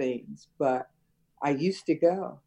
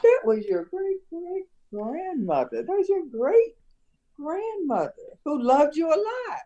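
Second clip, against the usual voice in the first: proud storyteller; 10 semitones higher than usual. Retold or imitated. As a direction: present grandma proudly to the little ones. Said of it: "You have to tell them".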